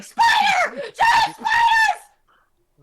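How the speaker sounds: fleeing partygoers screaming in terror; screaming in panic. Acted. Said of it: "running out screaming".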